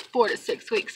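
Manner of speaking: soft, bored mock clerk. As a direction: toss it off as if filing your nails. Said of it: "it was her attitude".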